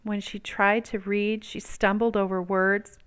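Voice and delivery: ordinary delivery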